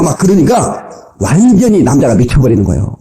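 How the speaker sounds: ordinary talk, nothing marked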